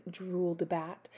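ordinary delivery, nothing marked

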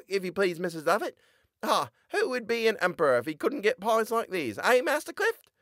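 put-on high boyish voice; a lively lad answering; young boy character voice; Tobias, boyish voice. A narrator's reading.

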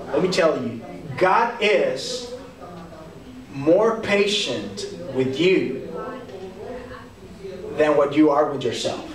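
ordinary talk, nothing marked

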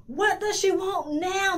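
high, loud angry shout. A narrator's reading. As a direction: shout it over the waves. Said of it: "the flounder shouted".

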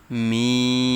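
মিন held out long and even, three counts; long drawn vowel. Shown as a demonstration. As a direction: stretch মিন for three alifs. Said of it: "এখানে তিন আলিফ টেনে পড়তে হবে". (মিন টেনে তিন আলিফ পড়া)

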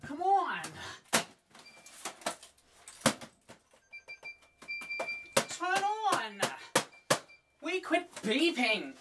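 frustrated yelling at a console; high, exasperated pleading; raging gamer. Real, not acted.

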